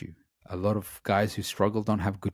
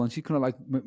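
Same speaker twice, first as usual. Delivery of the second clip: ordinary talk, nothing marked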